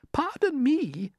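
very high, slow, polite falsetto; murmuring ladybird. A narrator's reading. Ladybird turning pale, high timid murmur. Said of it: "murmured the ladybird".

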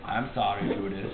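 low, soft apology in character; mock apology. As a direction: apologize quietly, play-acting. Acted grudging apology.

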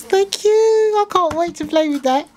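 high-pitched, delighted gushing; excited thanks. Real, not acted. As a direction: thank a friend, thrilled to bits. Thrilled thanks for a gift.

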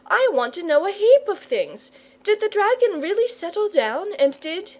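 put-on high, insistent child's questioning; eager cry. A narrator's reading. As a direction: press him with questions in a high voice. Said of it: "cried Charlotte".

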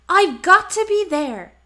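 can't wait to be there; eager exclamation. Acted. Animals eager to attend.

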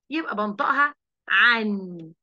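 عن said slowly as ain plus a and an added nun; a teacher sounding out عن slowly; tanween an. Shown as a demonstration. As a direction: draw out عن with a clear final n. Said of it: "حاطة جنبها نون".

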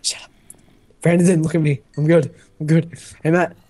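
normal talking voice

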